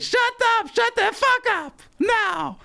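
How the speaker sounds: high screech, mimicking a furious woman; imitated screaming. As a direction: shriek it, stretching out the last word. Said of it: "She said".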